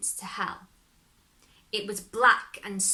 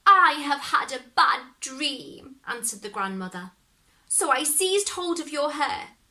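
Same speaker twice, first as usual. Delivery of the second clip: innocent excuse; 5 semitones higher, 5 dB louder than usual. Acted. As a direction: sweetly cover the trick. Grandmother excuses herself.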